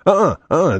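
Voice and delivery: sing-song uh-uh refusal; mock refusal. Insurer turns down a claim, smug sing-song uh-uh. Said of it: "they say".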